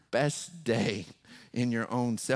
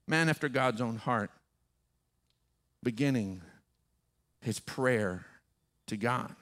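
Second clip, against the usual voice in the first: usual speaking voice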